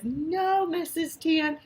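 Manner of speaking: high, loud mock scolding cry; playful exclamation. Teacher reads her own scolding sign to the class, playfully high.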